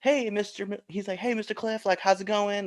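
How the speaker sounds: surgeon's greeting. Retold or imitated. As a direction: imitate a doctor's soft, high hello. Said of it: "the surgeon comes in".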